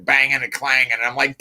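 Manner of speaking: usual speaking voice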